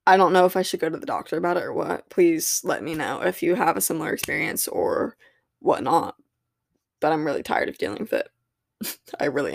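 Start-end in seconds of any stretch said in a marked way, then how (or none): none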